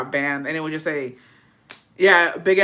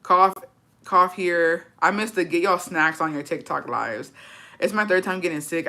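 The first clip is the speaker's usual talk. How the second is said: ordinary talk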